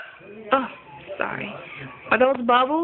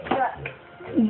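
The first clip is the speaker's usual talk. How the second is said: usual speaking voice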